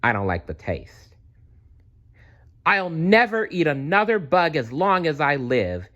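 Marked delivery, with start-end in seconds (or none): 2.7-5.9 high happy cheer. Acted. Stella Luna stuffing herself with mango, high cheer. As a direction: cheer with a full mouth of delight. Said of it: "cheered Stella Luna".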